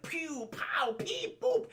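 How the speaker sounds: high playful pew pow sound effects; gunfire noises. Retold or imitated. Broadway replaying the movie shootout, high gun noises. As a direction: do cartoon gunshots like a kid.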